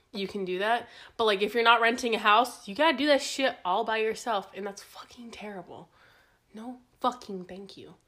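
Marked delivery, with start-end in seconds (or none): 4.5-8.0 unvoiced whispered aside. Real, not acted. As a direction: breathe it as a secret.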